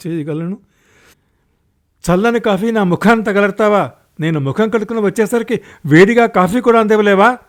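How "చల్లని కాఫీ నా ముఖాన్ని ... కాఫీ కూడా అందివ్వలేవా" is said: loud, high, rapid-fire scolding; furious fast tirade; angry roar. A narrator's reading. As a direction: roar at a wife in a temper. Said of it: "అని హుంకరిస్తూ".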